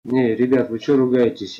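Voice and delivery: ordinary talk, nothing marked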